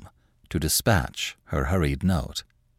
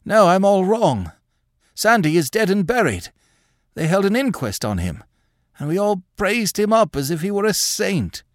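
put-on high, rambling, feverish old man's voice; agitated old man; 12 semitones higher than usual. A narrator's reading. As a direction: rave at her like a haunted old man. Craven's agitated ravings.

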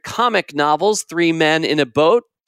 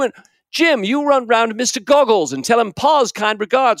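higher, bossy British uncle calling out orders; shouting Uncle Podger; 4 semitones higher than usual. Acted. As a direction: bark orders across the house like a flustered uncle. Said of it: "he would shout".

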